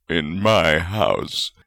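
slow, smug and pleased. A narrator's reading. Godric gloating to Salazar, slow and smug. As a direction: savour every word slowly. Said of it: "Godric added smugly".

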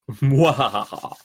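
slow evil cackle; mad scientist laugh. Pretend. Villainous laugh ending episode.

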